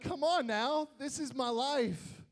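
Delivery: hammed-up disbelief, drag it out; mock exasperation. Little brother reacting to the absurd beating, mock exasperated. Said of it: "I'm like".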